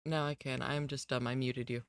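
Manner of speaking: ordinary delivery, nothing marked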